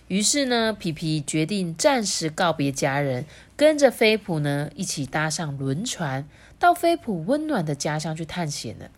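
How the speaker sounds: ordinary delivery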